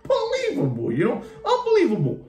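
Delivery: very high, laughing unbelievable; giggling high catchphrase; laughing exclamation. Real, not acted.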